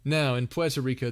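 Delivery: usual speaking voice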